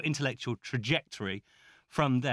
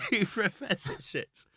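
amused echo with a laugh, slower; 4 semitones higher, 3 dB quieter, slower than usual. Real, not acted. Host echoing the guest's boast, laughing. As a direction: repeat it back through a chuckle.

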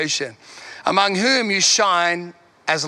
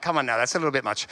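dry aside; 9 semitones lower, 6 dB quieter, much faster than usual. Retold or imitated. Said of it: "And I'm like".

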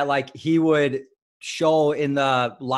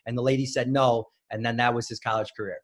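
usual speaking voice

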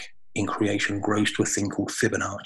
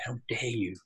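"How dare you?" whispered, softer, the "dare" falling; quiet whispered accusation; whispered challenge; 8 dB quieter than usual. Retold or imitated. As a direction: hiss it under your breath at God.

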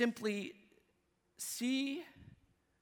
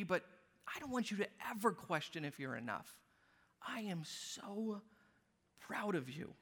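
much softer, ending in a near whisper; tender heavenly Father; 4 dB quieter than usual. Pretend. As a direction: reassure gently, almost whispering the last words. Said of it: "He goes".

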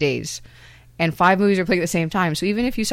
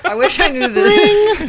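high mocking voice; 14 semitones higher, 9 dB louder, slower than usual. Mocking the fuck boy remark.

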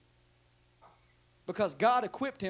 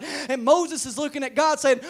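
raised, loud pulpit storytelling; fervent preacher winding up; rising preaching; 9 semitones higher, 13 dB louder than usual. Real, not acted. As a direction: build excitedly toward the punchline. Preaching builds to a shout.

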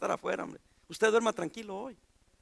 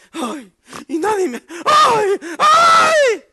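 rising, louder wails of "ay"; mock whining; 10 semitones higher, 10 dB louder, much slower than usual. Pastor mocking believers who wallow in trouble, whining. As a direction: ham up a self-pitying moan.

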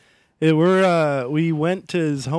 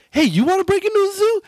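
much higher-pitched mock-eager buddy; eager imitated invitation; 4 dB louder than usual. Buddy inviting him to the zoo, eager imitated voice.

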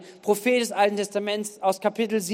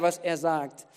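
normal talking voice

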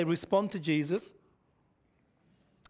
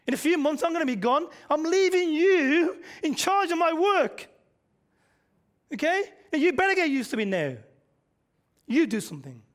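firm charge; 7 semitones higher, 4 dB louder than usual. Retold or imitated. Jesus voiced instructing his disciples.

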